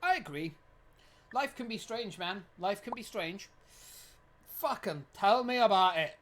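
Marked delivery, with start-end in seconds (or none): 4.6-6.2 slow, loud mock Scottish grumble; Scottish accent. Agreeing life is strange, in a loud mock Scottish accent. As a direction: lean into a Scottish burr. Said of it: "I don't know why I'm going Scottish".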